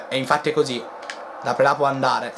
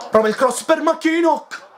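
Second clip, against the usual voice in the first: excited; 12 semitones higher, 3 dB louder than usual. Real, not acted. Cross into the box.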